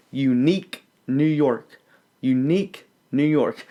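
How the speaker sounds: "Unique New York" with the final k popped clearly; crisp k sounds. Shown as a demonstration. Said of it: "Make sure you get the k".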